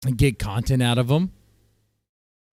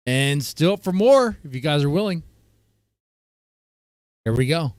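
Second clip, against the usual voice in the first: ordinary delivery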